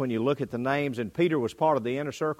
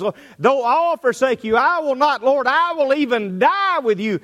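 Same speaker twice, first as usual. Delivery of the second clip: high, loud, fervent declaration; a loyal disciple swearing he'll never leave; bold vow; 13 semitones higher, 10 dB louder than usual. Acted. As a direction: proclaim it boldly, chest out. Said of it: "stands up boldly and says".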